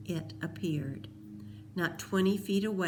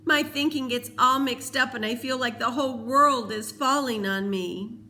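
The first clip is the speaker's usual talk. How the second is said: tearful outpouring; 6 semitones higher, 6 dB louder than usual. A narrator's reading. Peter sobbing to Cole, high tearful outpouring. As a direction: pour it out through tears.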